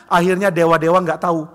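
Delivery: usual speaking voice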